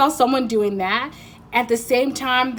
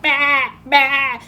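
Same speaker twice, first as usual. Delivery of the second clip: loud, high goat bleats; put-on goat cry, pushed out hard; bleating goat; 5 semitones higher, 6 dB louder than usual. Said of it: "this goat that's like".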